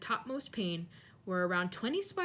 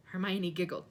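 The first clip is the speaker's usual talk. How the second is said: ordinary delivery, nothing marked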